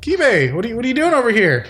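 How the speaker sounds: a high quick-talking crab friend. Acted. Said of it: "and he says".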